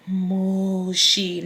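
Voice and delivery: soft, drawn-out eerie call; grandmother calling out. Retold or imitated. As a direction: call the nickname slowly, like a voice from above. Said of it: "the way she's calling my name".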